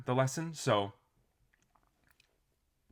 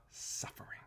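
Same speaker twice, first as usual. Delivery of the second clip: slow breathy whisper; 13 dB quieter than usual. Real, not acted. Coach closing the lesson on suffering, in a slow whisper. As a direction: drop to a hush on the last words.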